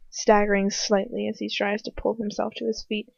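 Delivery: usual speaking voice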